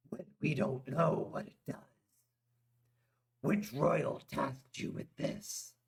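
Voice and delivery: a hint of a stern warrior; Drog voice. A narrator's reading. Drog's voice.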